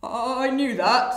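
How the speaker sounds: slow, loud bluff in crocodile voice; lying crocodile. Acted. Crocodile fooled by flamingos, slow lying bluff. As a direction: stretch it out as a bluff.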